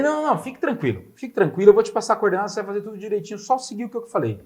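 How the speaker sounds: lightly voiced breezy reassurance; mock reassurance. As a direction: imitate a friend brushing off worries.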